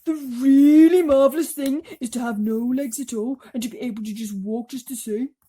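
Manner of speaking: high, loud grumbling worm; earthworm complaining. Acted. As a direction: worm grumbling about the centipede.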